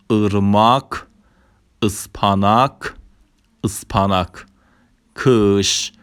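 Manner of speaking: Turkish words said singly with dotless ı; dotless ı demo. Shown as a demonstration. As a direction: model "ارماک" as ırmak, word by word.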